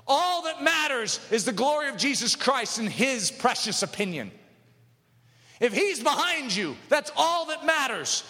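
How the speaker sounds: building, high-pitched preaching fervour; impassioned preaching. Real, not acted. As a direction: drive each phrase home to the congregation. Preacher builds to full force.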